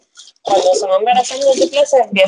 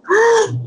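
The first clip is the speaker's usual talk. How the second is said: high sharp shocked gasp; mother-in-law hearing it is twins, gasping; imitated gasp; 12 semitones higher than usual. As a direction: suck in air like the mother-in-law. Mother-in-law nearly fainting.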